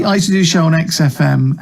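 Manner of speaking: ordinary delivery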